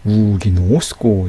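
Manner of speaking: 우기 노스코 run together slowly with long vowels; natural linked pronunciation. Shown as a demonstration. Teacher shows students natural linking, slow linked pronunciation of 우기 노스코. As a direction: draw out the vowels of 우기 노스코 as one unit. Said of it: "발음하는 게 자연스러워요".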